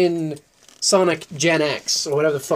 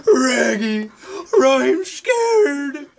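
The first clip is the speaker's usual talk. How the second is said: hammed-up fear; 8 semitones higher, much slower than usual. Parody of a scared cartoon character.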